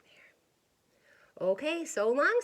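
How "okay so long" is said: bright cheerful goodbye, a bit louder; tumbleweed waving goodbye cheerfully. Acted. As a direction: toss off a happy farewell. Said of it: "said Rosie cheerfully".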